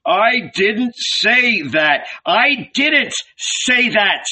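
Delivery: slow, high, emphatic protest; despairing shout. A narrator's reading. Jesus waves his arms at the mob, despairing shout. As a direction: cry it out word by word in despair. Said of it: "he shouted".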